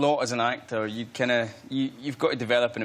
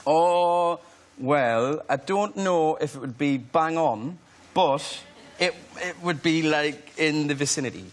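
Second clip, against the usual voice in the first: slow, drawn-out Geordie accent; careful Geordie accent demo; South Shields accent; slower than usual. Shown as a demonstration. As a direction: try on a South Shields accent, taking it slowly. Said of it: "a South Shields accent?".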